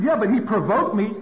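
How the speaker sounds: loud, high-pitched excuse; defensive protest. Retold or imitated. Caller justifying the punching to sponsor, defensive protest.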